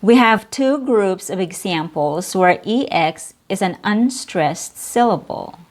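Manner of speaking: ordinary talk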